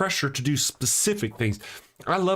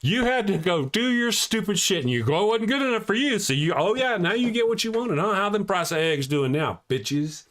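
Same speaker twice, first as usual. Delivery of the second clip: taunting; 6 semitones higher than usual. Retold or imitated. Gloating rant at opponents.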